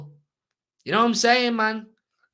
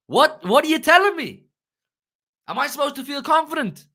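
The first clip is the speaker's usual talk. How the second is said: high, loud, incredulous outburst; real angry exasperation; 7 semitones higher, 4 dB louder than usual. Real, not acted. Fan ranting to live chat about injured players, angry and exasperated. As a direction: fire the questions out, pitched high and exasperated. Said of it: "you are never this angry".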